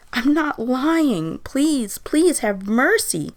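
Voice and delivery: high, slow, loud pleading; begging. A narrator's reading. Old man begging the accusing voices for mercy, desperate. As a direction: beg desperately, stretching the words high. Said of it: "Peter begged".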